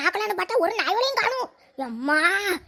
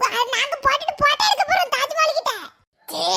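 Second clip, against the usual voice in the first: ordinary delivery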